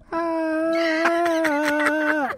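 long high frog wail from inside a snake; drawn-out high frog cry; imitated muffled frog scream. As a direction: squeal like a swallowed frog. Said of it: "you could hear".

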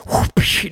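unvoiced whoosh and crack of a whip; whip crack sound. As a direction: crack an imaginary whip with your mouth. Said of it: "with the whip and the vaqueros".

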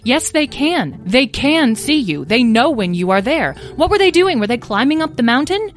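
exasperated mother. A narrator's reading. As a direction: argue it point by point with your child. Mother argues with son.

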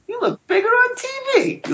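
very high, squeaky excited retelling. Retold or imitated. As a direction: let the voice shoot up, mimicking your own excitement. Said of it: "your voice goes that high".

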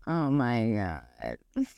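low, slow, soft oh my God; mortified groan. Retold or imitated. Woman realizing her leggings were see-through all along, low and slow. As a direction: sink into slow, low embarrassment.

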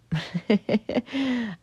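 high giggling laugh. Real, not acted. Podcaster tickled after telling listeners she loves them, laughing. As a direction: let out a long, high giggle.

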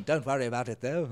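posh nineteen fifties voice. Acted. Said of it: "posh nineteen fifties voice".

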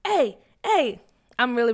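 ordinary delivery

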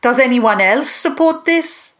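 high, forceful monster judge; monster council leader. Acted. Mama Spagnoni challenges the gathered monsters, high forceful ruling.

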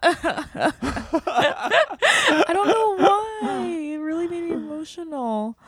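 choked up, fighting tears; real crying. Real, not acted. Co-host thanking guests, crying real tears. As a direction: voice breaking with tears, pitch high. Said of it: "I'm getting emotional again".

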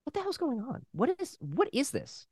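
a stunned, hushed what-is-going-on; baffled aside. Retold or imitated. Son retelling his bewildered reaction to the school, hushed and high.

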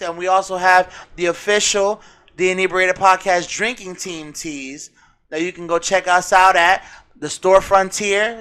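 usual speaking voice

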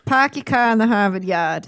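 hammed-up Boston accent, r's dropped. Shown as a demonstration. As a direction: show off a cliché Boston accent. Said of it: "That was a terrible accent".

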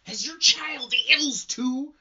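put-on a fragile old lady's question; put-on old woman. A mother asks Hale about her child, high and very slow.

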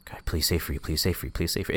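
begging under his breath; inner pleading. Pretend. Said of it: "without the thoughts".

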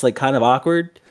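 normal talking voice

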